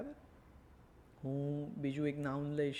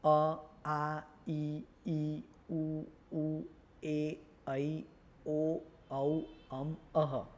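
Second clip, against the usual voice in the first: measured, separated vowel-by-vowel recitation; even, level vowel drill; reciting vowels; much slower than usual. Shown as a demonstration. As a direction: recite the Gujarati vowels one at a time with small pauses. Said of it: "કયા કયા?".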